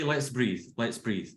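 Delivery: softening, calm invitation to breathe; calm teacher guiding a breath; soothing breathing cue. Shown as a demonstration. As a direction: settle the group gently. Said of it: "and then we'll say".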